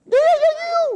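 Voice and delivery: excited agreement. Real, not acted. Speaker cheers an audience member's answer, high excited yeah. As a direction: squeal your approval at the answer.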